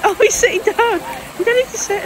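high-pitched cooing at a dog; amused dog talk. Real, not acted.

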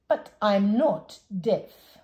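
put-on old man stubbornly denying deafness; firm denial. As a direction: drag each word, indignant. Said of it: "said the saucepan man".

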